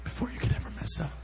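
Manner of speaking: softly, almost whispered; hushed reassurance. Real, not acted. Preacher reassuring those who may mess up, hushed delivery. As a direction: let it fall to a gentle hush.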